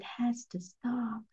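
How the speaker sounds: slow, breathy whispered warning; hushed, slow whisper of a final point. Real, not acted. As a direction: lean in and whisper it slowly. Closing line, hushed for emphasis.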